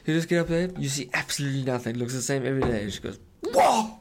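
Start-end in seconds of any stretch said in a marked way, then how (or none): 3.4-3.9 high, loud laughing "Whoa!" like a barking dog; dog bark imitation. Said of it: "chucks a bark down this thing".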